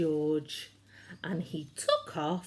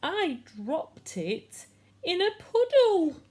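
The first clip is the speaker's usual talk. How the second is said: crying fox; 4 semitones higher, 4 dB louder than usual. A narrator's reading. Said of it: "a fox who was crying".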